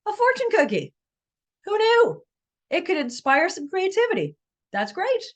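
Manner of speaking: high, bright, cheerful wrap-up; excited. Real, not acted. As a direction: sound delighted and upbeat.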